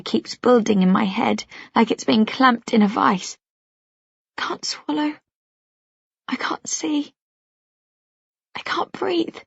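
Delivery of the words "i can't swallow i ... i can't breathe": breathless, higher, soft panicked whisper. Acted. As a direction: gasp the words out as the panic takes over. Panic attack, repeated, worse.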